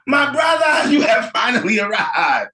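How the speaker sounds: loud, proud booming greeting; grand welcome. Acted. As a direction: welcome him like royalty.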